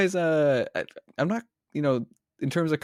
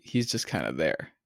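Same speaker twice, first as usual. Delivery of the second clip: normal talking voice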